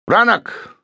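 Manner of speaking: high called name; yelled name. A narrator's reading. Markham yells for his sergeant. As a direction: yell across the boat. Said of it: "Markham yelled".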